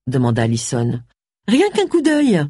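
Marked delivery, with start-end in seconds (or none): 1.5-2.5 aigu, suppliant pour insister; insistante. A narrator's reading. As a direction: insiste d'une voix nettement plus haute. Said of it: "insista Agatha". (ton insistant, plus aigu)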